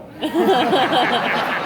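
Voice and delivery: high loud laugh; embarrassed laugh. Real, not acted. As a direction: laugh it off awkwardly. Laughing at failed attempt.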